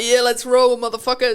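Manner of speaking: brash, like a Western gunslinger ready to ride; mock badass rallying call to the range; tough-guy swagger. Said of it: "and I'm like".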